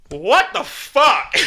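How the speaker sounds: stunned disbelief. Retold or imitated. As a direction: stretch it in dazed wonder. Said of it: "I just go".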